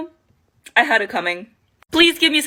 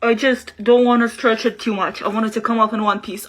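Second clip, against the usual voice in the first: usual speaking voice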